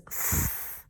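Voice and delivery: "f." as a voiceless hiss, no voice; just breathe the "f"; letter sound f. Shown as a demonstration. Teacher modeling F for students to repeat, a breathy "f". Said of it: "F says".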